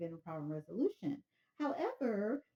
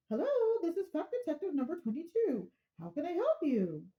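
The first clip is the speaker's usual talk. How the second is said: higher, brisk helpful detective; fact detective; 8 semitones higher, 4 dB louder than usual. Acted. Fact detective answering the caller's phone, higher and brisk. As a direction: answer like a cheerful hotline agent.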